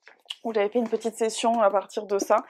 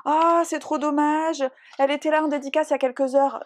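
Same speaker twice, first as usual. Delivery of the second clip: mock shop clerk; 5 semitones higher, 4 dB louder than usual. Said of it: "la meuf, elle a eu l'audace de me dire".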